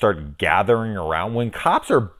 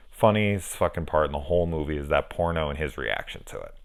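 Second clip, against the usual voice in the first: usual speaking voice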